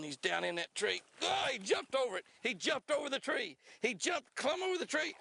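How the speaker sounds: can't believe the fish jumped the tree; excited. Real, not acted. Angler watching his fish jump a sunken tree, high excited hollering.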